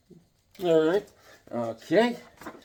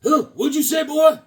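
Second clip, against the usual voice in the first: much higher, louder mock question; voicing drawn guy; 12 semitones higher, 7 dB louder than usual. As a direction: play the confused brute. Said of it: "He's like".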